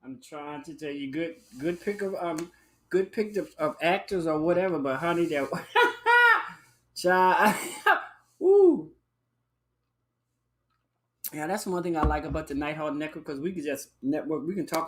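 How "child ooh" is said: high laughing squeals; shrieking laughter. Real, not acted. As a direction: cackle with delight. Laughing over the commercial.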